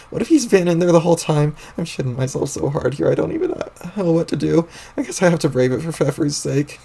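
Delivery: usual speaking voice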